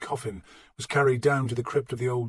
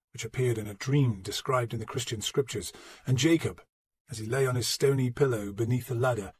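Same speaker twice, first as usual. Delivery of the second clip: usual speaking voice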